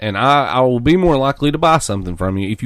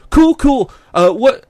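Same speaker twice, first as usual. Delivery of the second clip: much higher, louder bragging bro voice; 13 semitones higher, 7 dB louder, slower than usual. Retold or imitated. Imitating the clerk, high loud boasting bro voice. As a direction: boast about mods, hyped. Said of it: "He's like".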